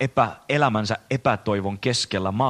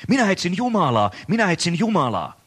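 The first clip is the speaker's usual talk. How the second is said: mock cry of a madman, pitched higher; a madman on the square calling out for God, pitched higher; 7 semitones higher than usual. A narrator's reading. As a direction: call out as a desperate seeker, not full volume. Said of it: "huusi huutamistaan".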